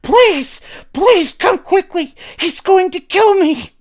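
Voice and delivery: high, loud panicked screaming plea; shrill terrified cry. A narrator's reading. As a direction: scream for help in terror. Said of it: "the shrillest, most frightening sounding voice ... cried out".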